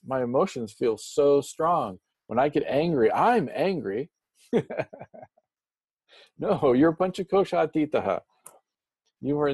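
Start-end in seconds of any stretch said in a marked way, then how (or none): 4.5-5.0 a quick, high amused laugh; brief chuckle; short laugh. Real, not acted. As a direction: laugh lightly at yourself. Amused at own remark.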